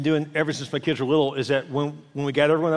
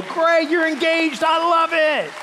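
high, drawn-out cheer; hammed-up celebration; 10 semitones higher, 3 dB louder, slower than usual. Celebrating a friend's engagement, hammed-up delight. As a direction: whoop it up in a high voice. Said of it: "celebrate other people's blessing".

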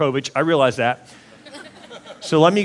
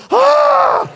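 high, loud shriek of horror; waking-up scream; 8 dB louder than usual. Pretend. Groom waking beside the wrong bride, shrieking in horror. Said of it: "you're like".

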